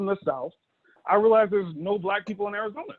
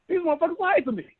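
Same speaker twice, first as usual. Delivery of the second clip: put-on indignant kid; 9 semitones higher than usual. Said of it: "You're like".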